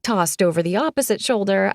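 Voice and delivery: usual speaking voice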